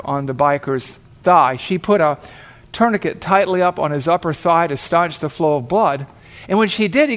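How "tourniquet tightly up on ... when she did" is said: ordinary delivery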